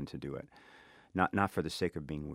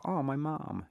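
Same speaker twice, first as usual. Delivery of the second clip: higher-pitched, mildly excited retold realization; delighted recognition; 9 semitones higher, slower than usual. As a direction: imitate suddenly spotting a familiar voice, pitch lifted.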